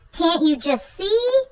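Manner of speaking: ordinary delivery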